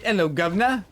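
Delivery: put-on British accent. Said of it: "say that in a British accent".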